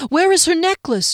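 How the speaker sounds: high, loud urgent cry; alarmed cry. A narrator's reading. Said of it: "she cried".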